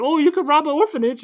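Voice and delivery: high-pitched, eager mock voice; mock suggestion. Friend retelling the film voices someone suggesting a robbery, high mock voice. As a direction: put on a high voice for the quote.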